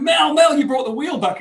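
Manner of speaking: high, loud burst, easing off; excited calling out. Retold or imitated. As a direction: call Mel over, imitating urgent excitement. Acted excited call to Mel.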